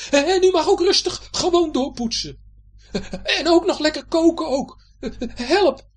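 high, loud stammering pleading; frightened pirate Hendrik. Acted. Hendrik begging Betje for mercy, frightened. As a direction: bargain desperately to stay aboard.

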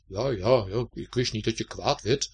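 lightly mumbled, grudging reply; muttering. A narrator's reading. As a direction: mutter it, taken aback and sulky. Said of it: "mompelde Winter". (licht mompelend)

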